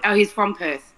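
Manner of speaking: usual speaking voice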